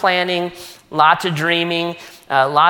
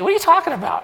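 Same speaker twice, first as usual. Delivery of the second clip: squeaky, much higher mock disbelief; mock outrage; 12 semitones higher, much faster than usual. Pastor jokingly refuses friend's leaving.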